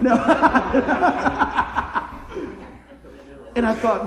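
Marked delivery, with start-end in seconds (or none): 0.2-2.7 long, high burst of laughing; real, helpless laugh; hearty laughter. Real, not acted. Preacher laughing at his own retold exchange, hearty laughter.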